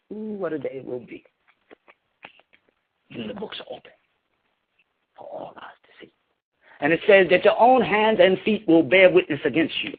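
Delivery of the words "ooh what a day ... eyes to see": hushed awe. Acted. Foretelling judgment day, hushed.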